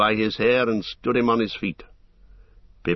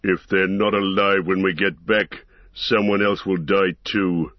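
menacing orc leader voice; gruff orc captain. Acted. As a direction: threaten like a brutal orc captain. Ugluk the orc captain speaks.

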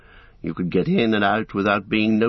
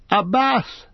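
high, bright cry of delight; 11 semitones higher than usual. A narrator's reading. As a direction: cry out with glee at the sight. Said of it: "cried Pippin".

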